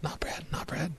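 soft unvoiced whisper. Real, not acted. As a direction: breathe the name, barely audible. Repeats the name under his breath.

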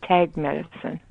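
ordinary delivery

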